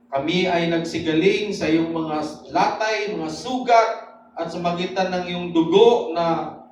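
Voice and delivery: loud, fervent prayer to God; impassioned prayer. Real, not acted. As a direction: pray out boldly with rising emphasis. (taimtim at malakas na panalangin)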